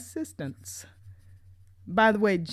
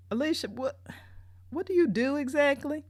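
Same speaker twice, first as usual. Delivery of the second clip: higher, puzzled and suspicious; baffled question; 7 semitones higher than usual. Retold or imitated. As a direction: ask as it dawns on you.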